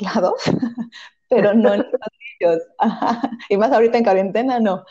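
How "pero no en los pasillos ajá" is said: laughing through the words. Real, not acted. Mother joking about toys all over her house, laughing. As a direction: let amusement break into the phrase.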